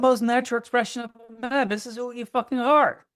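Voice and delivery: high, loud, passionate push; rally the listener to own it; fired-up urging. Real, not acted. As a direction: coach urging men to own their desire, high and loud.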